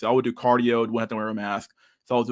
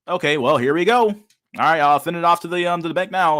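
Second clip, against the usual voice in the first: lightly voiced operator, higher and louder; Honkler voice; 5 semitones higher, 5 dB louder than usual. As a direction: play the cheery clerk sending it off. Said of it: "thank you, um, Honkler".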